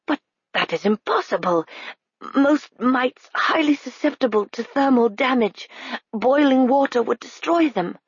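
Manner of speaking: high, strained officer voice; a stricken colonel protesting; paralyzed colonel. A narrator's reading.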